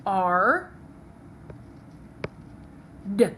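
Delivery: "R" as ar, then "D" as a short duh, far apart; sounded out. Shown as a demonstration. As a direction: sound out "R. D." one sound at a time. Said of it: "Write the word hard".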